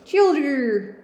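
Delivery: put-on Childer cried out high and loud, Yorkshire vowels; Yorkshire dialect. Shown as a demonstration. Reader repeating the servant's word in Yorkshire dialect. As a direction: call Childer with a Yorkshire lilt. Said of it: "Yorkshire dialect".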